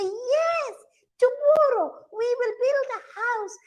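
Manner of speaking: very high, singsong mother monkey. Acted. Mother monkey agreeing to build a house, high-pitched. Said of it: "mother monkeys would shake their head and say".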